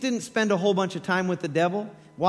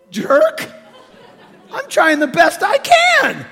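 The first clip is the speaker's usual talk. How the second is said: laughing, much higher and louder half-shout; mock outburst; 11 semitones higher, 10 dB louder, slower than usual. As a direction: yell back at Jesus in jest, slowly. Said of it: "I'd be like".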